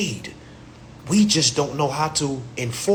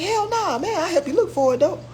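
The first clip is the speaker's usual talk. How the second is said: much higher, fast mocking voice; borrower's brush-off; 14 semitones higher than usual. Borrower brushing off the lender, high fast mocking voice. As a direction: toss it off like a cheeky borrower. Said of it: "They're like".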